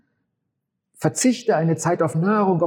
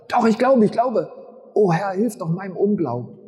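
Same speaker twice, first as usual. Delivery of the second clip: higher and louder, a father's panicked cry; panicked plea; 4 semitones higher, 5 dB louder than usual. Retold or imitated. Father begging Jesus for help, panicked and louder. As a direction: blurt it out in sudden panic. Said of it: "der kommt völlig in Panik".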